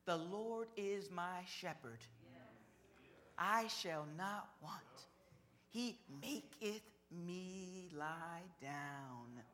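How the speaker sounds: Psalm read slowly, each phrase drawn out; slow scripture reading. Acted. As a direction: savor every word of the verse as it's recited. Reciting the psalm.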